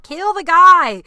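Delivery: loud, high, drawn-out mock yell; exasperated yell. Retelling impatient reaction to show.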